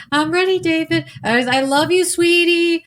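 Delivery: high doting voice answering David; sweet reply. Retold or imitated. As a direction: gush back lovingly in a high voice. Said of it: "And I'd be like".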